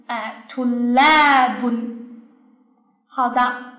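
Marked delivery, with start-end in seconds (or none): 0.5-2.0 tulabun drawn out, leaning hard on la; stress on la. Shown as a demonstration. Teacher repeats the model word, tulabun with long stressed la. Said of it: "所以这个词读起来就是".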